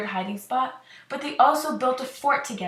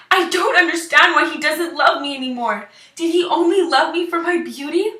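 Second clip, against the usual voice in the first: louder, raised, tearful lament; crying; 6 semitones higher, 9 dB louder than usual. Acted. Heartbroken princess walking home after rejection, tearful lament. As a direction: wail it out through tears. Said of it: "did nothing but cry".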